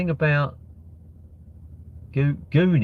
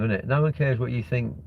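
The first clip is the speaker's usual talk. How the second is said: ordinary talk, nothing marked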